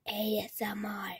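softly whispered ASMR. Real, not acted. Girl whispers to viewers for ASMR, hushed whisper. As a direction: breathe it like an ASMR clip. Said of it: "Just hear this satisfying".

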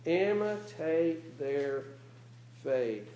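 slow, dropping to soft on faith; reading the verse with slow weight; slow emphasis. Real, not acted. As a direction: lean into each word, slowly.

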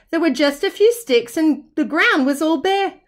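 ordinary delivery, nothing marked

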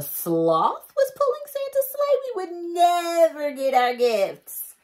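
high, slow and loud wail; mock despair. Reader joking about a sloth sleigh, high slow wail. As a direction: wail it like it's a tragedy.

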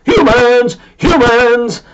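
high, loud excited cry. A narrator's reading. Bob thrilled to hear about humans, high loud cry. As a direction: yell it with pure glee.

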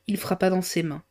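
ordinary delivery

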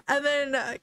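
normal talking voice

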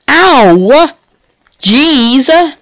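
high, loud sudden cry; real startled outburst; startled yelp. Real, not acted. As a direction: yelp as if hurt by surprise. Startled pain reaction.

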